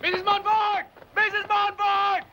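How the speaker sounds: high, drawn-out calls for help; urgent, repeated shout to a neighbor; shouting like fire. Acted. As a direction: holler the name as if there's a fire. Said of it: "Kung baka sigaw ka, parang may sunog".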